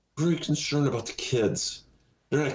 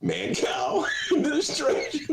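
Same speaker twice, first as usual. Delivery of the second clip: laughing shout; 11 semitones higher than usual. Real, not acted. Punchline through laughter.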